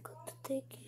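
barely audible, careful speech; stealthy whisper. Acted. Mouse reaching for the bait, very softly. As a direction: keep it tiny and hushed.

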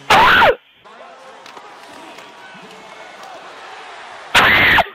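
short loud screams; real raging yell; frustrated scream. Real, not acted. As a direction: scream in separate bursts. Screams at the game.

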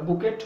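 বুকেট as "BOO-ket", final t sounded; mispronunciation. Shown as a demonstration. Teacher quoting how some people say it, mispronunciation. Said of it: "কেউ বলে".

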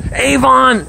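high, bright call of the dog's name. Real, not acted. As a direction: call him over from across the yard. Calling his dog by name.

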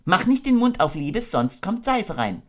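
high, gentle mother kangaroo voice; motherly Kanga. Acted. Kanga tucking her baby into bed, motherly. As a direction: coo it like a doting mother.

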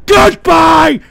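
loud, high, slow farewell bark; angry shouted goodbye. Pretend. Host signing off on callers, angry shouted goodbye. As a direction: slam the goodbye at them.

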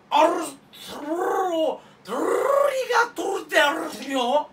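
rolling every r, high, loud and slow; trilled r chant, shouted high; rolled r. Acted. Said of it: "ここで巻き舌で喋るわ".

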